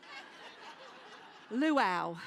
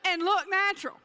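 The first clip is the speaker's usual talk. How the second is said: louder, higher punchline; 6 semitones higher, 9 dB louder than usual. Acted. Telling the audience the final challenge, big comic punch. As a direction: hit the tag line big.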